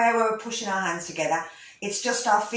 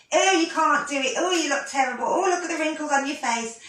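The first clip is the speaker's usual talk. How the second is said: higher, nagging inner critic; mock a harsh voice in the head, pitched up; loud critical voice; 6 semitones higher than usual. Teacher imitating the constant critic in our heads, loud and nagging. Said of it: "there's a loud voice in our head".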